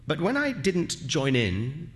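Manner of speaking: plain reading voice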